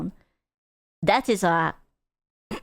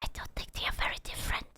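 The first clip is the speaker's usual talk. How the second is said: fast hushed whisper; whispered aside; 13 dB quieter, much faster than usual. Real, not acted. Streamer whispering a reluctant aside to chat. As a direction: lean in and whisper quickly.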